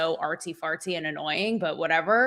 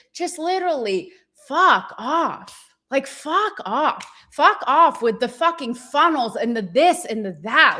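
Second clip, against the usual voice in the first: louder fed-up dismissal; fed-up rant; 4 semitones higher, 4 dB louder than usual. Real, not acted. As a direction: tell the posers off for real. Ranting at flashy influencers.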